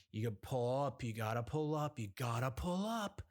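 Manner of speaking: emphatic. Retold or imitated. Cop to a waiting driver, emphatic. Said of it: "again, emphatically".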